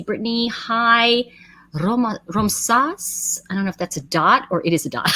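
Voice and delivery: ordinary talk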